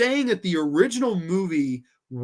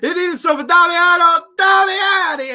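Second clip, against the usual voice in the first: high, drawn-out mock holler; hammed-up shout; 12 semitones higher, 10 dB louder, slower than usual. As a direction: belt it out far above normal talk. Mock outburst over limp movie.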